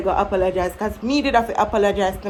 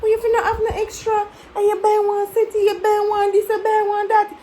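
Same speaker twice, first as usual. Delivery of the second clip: mock teen back-talk; 10 semitones higher than usual. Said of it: "Me I say".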